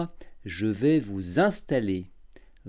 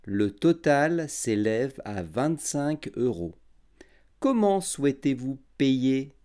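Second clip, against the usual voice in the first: every syllable clear and unhurried; over enunciating; 5 semitones lower than usual. Shown as a demonstration. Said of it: "j'articulerai bien et je parlerai lentement".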